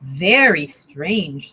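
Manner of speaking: low, slow mom voice; puzzled mom. Acted. Mom slowly doubting Stephanie's idea. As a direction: drawl it out, puzzled. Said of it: "said the mom".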